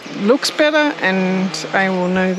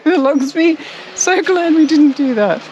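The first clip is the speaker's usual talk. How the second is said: laughing; 7 semitones higher, 3 dB louder than usual. Real, not acted. Laughing at own mistake.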